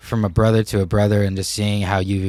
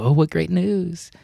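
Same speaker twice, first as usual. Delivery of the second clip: high, slow townsfolk cooing; mock excitement; 9 semitones higher, slower than usual. Townspeople to farmer as son escapes war, mock excitement. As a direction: imitate townspeople delighted, stretched and high-pitched.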